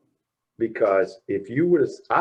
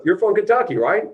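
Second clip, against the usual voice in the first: mock stern father; 10 semitones higher, 7 dB louder than usual. Father grilling him, retold.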